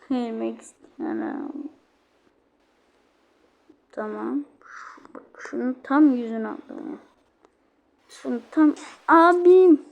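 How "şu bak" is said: whisper. Real, not acted. Whispered pointer.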